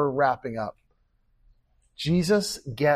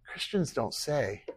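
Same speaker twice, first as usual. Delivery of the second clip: normal talking voice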